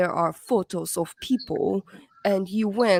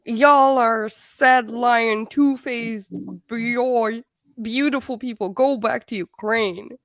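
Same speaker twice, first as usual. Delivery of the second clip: mock Southern drawl, louder and dragged out; terrible Southern accent; 3 dB louder, slower than usual. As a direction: ham up a bad Southern accent, stretching y'all. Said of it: "this was terrible Southern accent".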